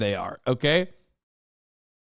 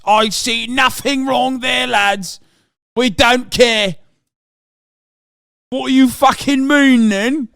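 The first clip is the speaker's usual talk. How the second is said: mock British copper, pitched higher and slow; British accent; 7 semitones higher, 5 dB louder, slower than usual. Mocking UK police ignoring Tate's crimes, slow high British accent. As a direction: ham up a careless British cop, higher and slower. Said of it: "UK police literally was just like".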